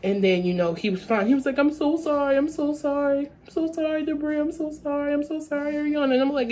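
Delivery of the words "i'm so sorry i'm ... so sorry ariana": high, softening, pleading apology; mock tearful apology. Friend apologizing through tears to the girls. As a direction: beg forgiveness like a crying boy. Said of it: "he starts apologizing to me".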